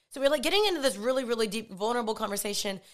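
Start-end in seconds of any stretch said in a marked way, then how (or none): none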